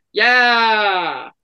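loud victorious yeah; a big gloating yeah; triumphant shout. Acted. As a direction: crow it like you just won. Triumphant shout after movie quote.

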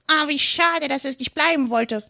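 high-pitched, regretful parrot voice; sighing parrot. A narrator's reading. Parrot laments the departed mouse, sighing. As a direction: sigh your regret as a high-voiced bird. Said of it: "seufzte der Papagei".

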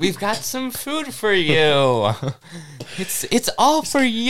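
high, slow cheery offer; chirpy newcomer. Retold or imitated. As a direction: sing it out like a naive host. Voicing cheerful supply ship.

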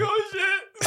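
very high squeal through laughter; hammed-up stunned kid, cracking up; mock shock. Acting out childhood reaction to film.

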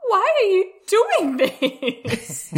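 a half-laughing plea, drawn out high; mock pleading. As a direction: plead slowly through a laugh. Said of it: "be like".

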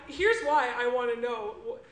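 ordinary talk

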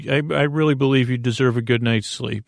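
normal talking voice

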